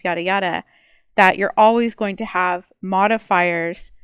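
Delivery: ordinary talk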